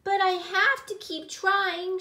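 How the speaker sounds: high, loud mock-crying child voice; tearful. A narrator's reading. Gilly refusing to give up, high tearful plaint. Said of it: "she muttered while crying".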